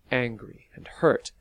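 ordinary delivery

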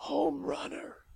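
put-on high, thin old man's voice; a frail old man quavers knowingly; quavering old man. A narrator's reading. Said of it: "he quavered".

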